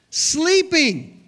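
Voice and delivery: high, loud, drawn-out reveal; preacher revealing Peter was asleep; incredulous punchline. Acted. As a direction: land the word like a surprise. Punchline of Bible reading.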